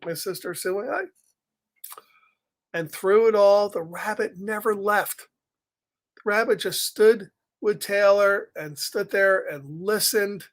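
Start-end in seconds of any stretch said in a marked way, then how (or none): none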